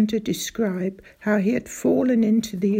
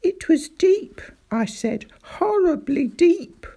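earnest alarm; 9 semitones higher, 5 dB louder than usual. Acted. Boy stresses the danger.

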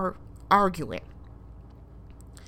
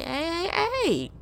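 imitating the woman; 9 semitones higher than usual. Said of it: "the woman is looking over like".

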